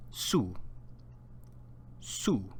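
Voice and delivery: Su said alone with a pure u vowel; Spanish su. Shown as a demonstration. Teacher says Su for students to repeat. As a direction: model Su clearly. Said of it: "repeat after me".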